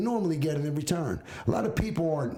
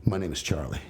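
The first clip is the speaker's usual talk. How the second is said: low, smooth introduction; smooth young romancer; 8 semitones lower than usual. Acted. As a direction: play the would-be charmer, pitched way down. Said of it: "That's how it's gonna sound".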